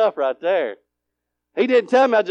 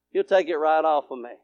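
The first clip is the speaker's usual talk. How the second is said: ordinary talk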